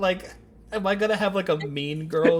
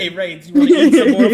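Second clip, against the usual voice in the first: laughing tease; 5 semitones higher, 10 dB louder than usual. Real, not acted. Teasing a friend, laughing.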